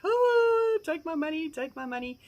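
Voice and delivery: squealing high and loud, then fading; gushing. Retold or imitated. Retelling her dream-farm rush, high excited gush. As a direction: swoon over the farm like it's already yours. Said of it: "I was like".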